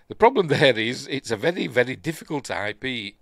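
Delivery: lightly put-on German accent; mild German accent imitation. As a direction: redo the quote with a hint of German accent. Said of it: "That's how he talks".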